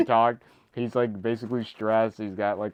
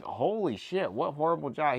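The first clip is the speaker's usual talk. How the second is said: higher-pitched mock amazement; retold shock; 8 semitones higher than usual. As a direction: act out being appalled, pitched up. Retold shocked reaction to the fine.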